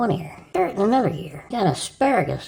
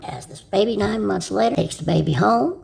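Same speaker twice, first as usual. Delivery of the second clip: usual speaking voice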